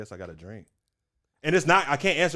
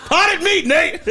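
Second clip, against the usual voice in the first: laughing shout; 9 dB louder, much slower than usual. Real, not acted. Laughing outburst about potted meat.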